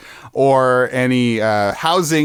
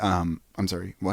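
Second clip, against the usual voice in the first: confused quiet server; 9 semitones lower, 9 dB quieter than usual. Retold or imitated. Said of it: "And then you'd be like".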